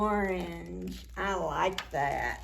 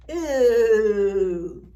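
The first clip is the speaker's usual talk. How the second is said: loud drawn-out mock alarm; loud mock ooh; 11 dB louder than usual. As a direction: wow the kids with a big ooh. Reacting to scary story moment.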